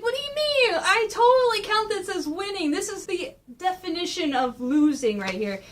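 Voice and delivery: high, incredulous protest; indignant Donnie. Retold or imitated. Donnie protesting to Shredder, high indignant comeback. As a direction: start very high, then argue it down. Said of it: "He's going like".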